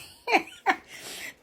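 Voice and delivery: high, loud burst of laughter; real high-pitched laughing fit. Real, not acted. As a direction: laugh out loud at your own joke. Laughs at own closing joke.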